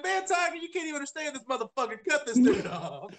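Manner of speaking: high-pitched, fast mock complaint ending in a laugh; retold exasperation. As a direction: act out being fed up with a baffling caller. Retold exasperation at baffling caller.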